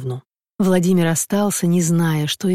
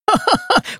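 sly chuckle. Acted. Dyundik laughs at the question.

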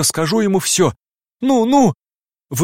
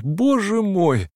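drawn-out anguish; 4 semitones higher, much slower than usual. A narrator's reading.

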